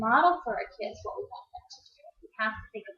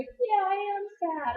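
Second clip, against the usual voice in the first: put-on child's reply; 10 semitones higher, 4 dB louder, slower than usual. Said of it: "they'll may look at me and go".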